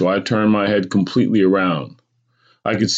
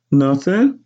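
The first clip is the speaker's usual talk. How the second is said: lightly voiced boy, higher; evasive child; 9 semitones higher, 4 dB louder than usual. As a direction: dodge mother's question in a small high voice. Boy evades mother's stern question.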